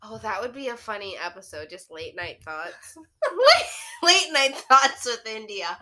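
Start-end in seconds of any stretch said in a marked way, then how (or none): 3.0-5.8 laughing. Real, not acted. Laughing while naming a show idea.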